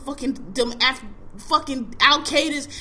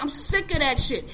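ordinary talk

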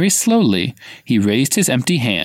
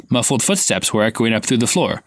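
ordinary delivery, nothing marked

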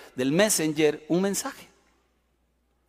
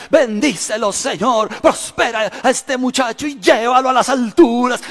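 louder, higher praying voice; praying over the young man with loud passion; passionate prayer; 4 semitones higher, 5 dB louder than usual. Retold or imitated. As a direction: bless him with all your passion.

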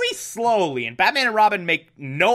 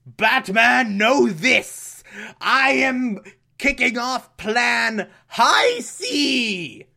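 higher, hammed-up villain rage; the Minstrel; 6 semitones higher, much slower than usual. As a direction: proclaim it angrily, stretching the final words. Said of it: "he gets really mad".

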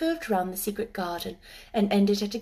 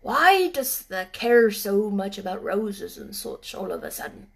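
put-on demanding old gardener; 5 dB louder than usual. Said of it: "he demanded".

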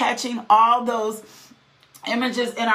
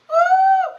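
very high, loud whoop; preacher whooping at the congregation; excited shout; 7 dB louder than usual. Real, not acted. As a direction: whoop with joy.

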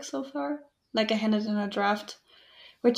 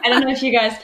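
laughing; 2 semitones higher, 10 dB louder, much faster than usual. Real, not acted. Still laughing.

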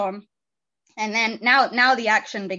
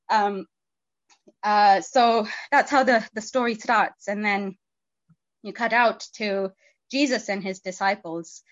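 ordinary delivery

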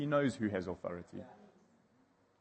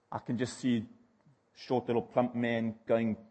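ordinary talk

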